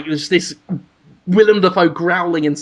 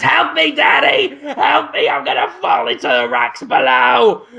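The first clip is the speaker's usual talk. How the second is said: high desperate cry for help; pleading baby; 5 semitones higher than usual. Acted. Baby dangling from cliff, begging Daddy for help. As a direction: beg Daddy from the cliff edge. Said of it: "it looks up and goes".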